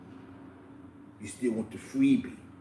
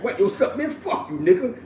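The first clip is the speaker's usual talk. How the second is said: higher, louder mock greeting turning rude; mock phone answer; 6 semitones higher, 6 dB louder than usual. Imitating a disrespectful friend answering the phone. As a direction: answer the phone cheery, then curse.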